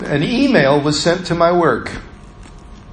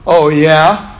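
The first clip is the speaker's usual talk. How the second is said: loud, challenging comeback; defiant challenge; 4 dB louder than usual. Retold or imitated. Driver answering the ride-along's doubt, loud and defiant. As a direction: throw it back as a dare.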